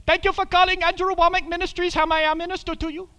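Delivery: put-on high, quick, polished Indian accent for a phone greeting. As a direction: answer the ministry line as a sweet Indian man. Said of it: "a precious little Indian man".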